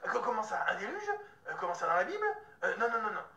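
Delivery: acted incredulous protest. Reacting to friend bringing up the Flood, scandalised disbelief. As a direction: balk in disbelief, stammering into repeated refusals. Retold shock at the Flood claim.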